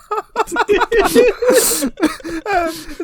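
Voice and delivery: high-pitched burst of laughter; helpless squealing laugh; real laughter. Real, not acted. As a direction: crack up laughing, long and high. Laughing at the prank story.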